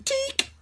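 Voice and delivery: high, quiet gunshot click; gunshot noise. Retold or imitated. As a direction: mimic a gun firing. Gunshot sound effect.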